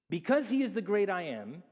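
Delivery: ordinary delivery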